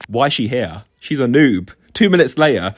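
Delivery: ordinary talk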